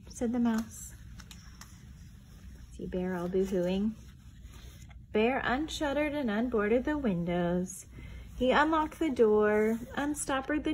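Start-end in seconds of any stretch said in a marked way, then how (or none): none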